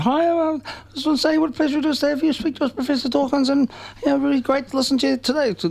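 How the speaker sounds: high-pitched imitation of a young girl; little girl. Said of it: "this little girl stood up and said".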